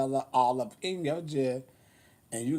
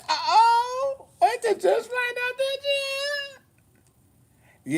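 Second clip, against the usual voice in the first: high excited squeal. Retold or imitated. Recounting her phone success, squealing with glee. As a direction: shriek it with delight. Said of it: "I said".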